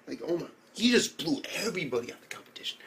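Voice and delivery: awed whisper. Real, not acted.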